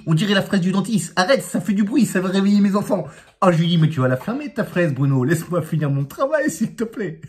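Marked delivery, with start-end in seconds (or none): 0.1-3.1 higher, louder mock complaint from Bruno; Bruno complaining. Bruno voiced complaining about noise. 3.8-7.2 teasing scold. Retold or imitated. Teasing Bruno to shut up.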